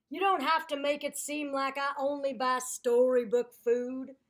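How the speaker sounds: loud, defensive complaining; indignant alligator. Acted. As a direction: argue back at the narrator. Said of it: "quoted alligator rant".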